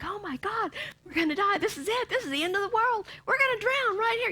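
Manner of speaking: high mock panic, half laughing; panicky disciples. Preacher acting out the disciples panicking in the storm. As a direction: ham up the frightened disciples. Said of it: "they're like".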